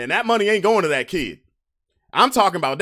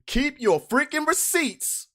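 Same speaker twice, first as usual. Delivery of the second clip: high, slow, leaning on freaking receipts; high drawn-out keep your freaking receipts; emphatic advice; 11 semitones higher, slower than usual. Real, not acted. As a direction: hammer home the warning.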